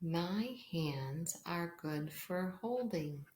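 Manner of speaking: slow, hushed storybook reading; reading to a toddler. Acted. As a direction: read slowly and softly for young children. Reads pattern book aloud.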